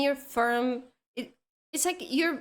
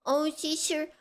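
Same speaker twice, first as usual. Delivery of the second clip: put-on whiny baby voice, higher; baby talk; 3 semitones higher than usual. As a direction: whine like a little kid. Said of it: "always talking like".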